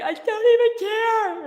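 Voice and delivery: very high, loud, stretched-out shout; reenacted yell. Saleswoman picturing herself in suburbia yelling at the sky, high shout. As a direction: belt it slowly up at the sky. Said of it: "and just yell at the sky".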